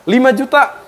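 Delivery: high-pitched astonished question; taken aback, pitch leaping up; surprised. Real, not acted. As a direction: echo the price in disbelief, voice jumping up. Reacting to a quoted price.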